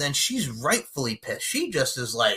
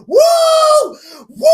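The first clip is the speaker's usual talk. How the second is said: very high, loud imitated "woo"; mock whoop; 12 dB louder than usual. Imitating Mom on the Ferris wheel, shrieked whoop. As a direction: squeal out a piercing mock whoop.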